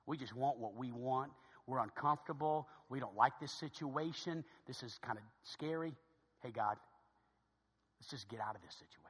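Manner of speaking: low, soft grumbling; self-centred attitude. Acted. Christians wanting out of the situation, grumbling attitude. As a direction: complain quietly under the breath. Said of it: "The attitude comes out".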